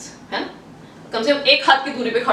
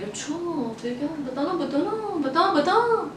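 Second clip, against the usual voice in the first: very soft, coaxing, nosy questioning; prying like a spy; 4 semitones higher, 6 dB quieter than usual. Acted. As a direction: wheedle it out in a hushed, rising pester. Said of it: "आप एक spy बन गए हो".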